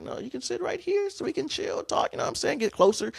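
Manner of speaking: high put-on flirty voice; a teasing high-pitched flirt; flirty impression. Showing friends how he invited her to sit, flirty falsetto. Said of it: "so I'm like".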